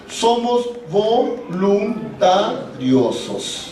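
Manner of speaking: very slow, measured syllables with gaps; drawn-out teaching emphasis. Real, not acted. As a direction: break voluntariosos into separate, deliberate syllables. Preacher spells out the key word.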